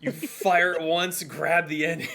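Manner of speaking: loud, slow excited telling. Real, not acted. DM excitedly describes action.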